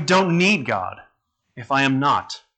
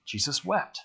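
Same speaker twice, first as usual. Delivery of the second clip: hushed quote; 9 dB quieter than usual. Shown as a demonstration. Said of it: "it says that scripture".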